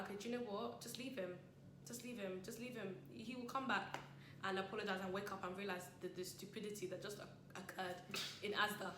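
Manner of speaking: very soft, measured resignation; hushed, patient reasoning; calm self-talk. Retold or imitated. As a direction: quietly tell yourself to let it go. Calming self-talk, letting him be.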